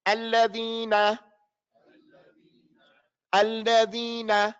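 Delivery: slow "Alladhina", every sound held clearly; repeat-after-me model. Shown as a demonstration. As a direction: model "Alladhina" slowly for a class. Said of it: "you all are after me".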